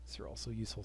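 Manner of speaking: fast, soft private whisper. Real, not acted. Gamer weighing policy cards in a quick whisper. Muttering to himself over options.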